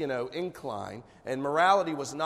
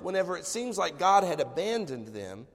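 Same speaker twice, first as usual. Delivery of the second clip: ordinary talk, nothing marked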